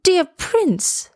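high, pleading little swallow; understated tearful appeal; tearful protest. A narrator's reading. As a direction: plead with a high, falling cry. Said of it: "he began to weep".

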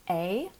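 'a' said as the letter name, ay, with rising pitch; long A sound. Shown as a demonstration. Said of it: "if they should pronounce it as".

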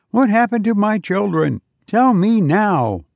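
high, pleading demand; a parent terrified for her children; frightened parent. Acted. Parent begging the robot, high and frightened.

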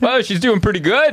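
mock proud reply.

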